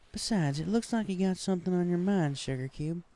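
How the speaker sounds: cheerful Southern pony teasing her friend; Applejack voice. A narrator's reading. Friend at swimming hole, high cheerful Southern pony voice.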